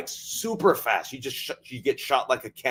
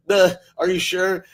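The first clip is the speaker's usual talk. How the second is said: put-on higher, louder, slow dopey kid voice; dopey scared kid; 6 semitones higher, 5 dB louder than usual. Dad mimics his son balking at the roller coaster, dopey and timid. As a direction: play the timid son doubting the ride.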